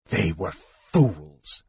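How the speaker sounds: low, slow and bitter; slow bitter scorn, voice dropped. A narrator's reading. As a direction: spit it out with contempt. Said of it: "said bitterly".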